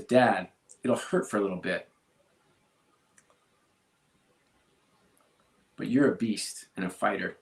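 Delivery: reading his daughter's note, voice catching; long pauses, fighting back tears, rising toward the end; choked up reading. Real, not acted. Father reads daughter's farewell note aloud, choked up. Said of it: "I'll probably get choked up reading this".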